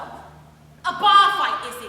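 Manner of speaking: "bar" drawn out slowly and loud; loud, very slow emphasis on "bar fight"; stressed reproach. Acted. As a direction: lean hard and long on "bar".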